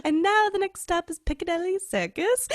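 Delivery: high, hammed-up British announcer; attempted British accent. Tourist imitating a London Tube announcement, in mock British accent. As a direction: overconfident bad British accent, pitched high. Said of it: "I thought I was nailing it".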